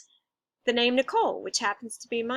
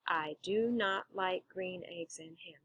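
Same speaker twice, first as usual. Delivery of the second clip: syllable by syllable; 3 semitones lower, 9 dB quieter than usual. Shown as a demonstration. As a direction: say each syllable of "I do not like green eggs and ham" separately and evenly. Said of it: "That's eight syllables".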